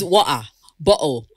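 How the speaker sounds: mock Cockney with glottal-stop t's; Cockney accent. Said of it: "Cockney accent, trenches parts".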